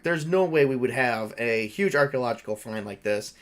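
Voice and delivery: ordinary talk, nothing marked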